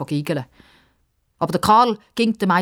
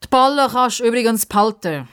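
higher, offhand parting call; raised voice thrown over the shoulder; 7 semitones higher, 4 dB louder than usual. A narrator's reading. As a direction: toss it back while leaving. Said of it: "ruft der Pippa noch zu".

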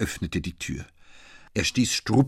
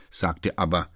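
plain reading voice

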